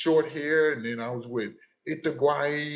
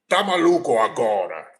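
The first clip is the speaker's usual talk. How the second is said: Portuguese; 5 semitones lower, 6 dB louder than usual. Acted. Acts out his Portuguese banter.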